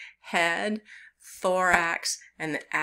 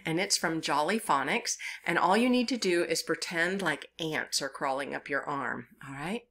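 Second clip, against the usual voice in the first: ordinary talk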